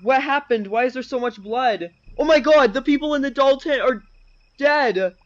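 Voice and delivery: high, loud horrified discovery; shocked alarm. Acted. Reading out the discovery in the tent, shocked.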